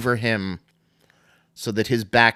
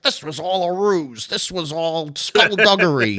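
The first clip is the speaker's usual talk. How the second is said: higher, hammed-up announcer outrage; mock meltdown; 6 semitones higher than usual. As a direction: play the commentator losing it, half in jest. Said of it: "Solie's going bon-, bonkers".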